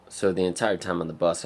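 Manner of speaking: normal talking voice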